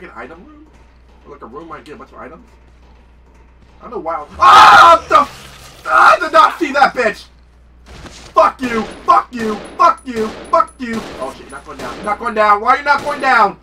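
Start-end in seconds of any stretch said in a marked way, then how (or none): 4.4-10.9 very loud, high enraged yells; real jump-scare rage; startled furious shouting. Real, not acted. 12.0-13.6 loud, high exasperated shouts; real angry frustration; frustrated yelling. Real, not acted. Zombie won't die.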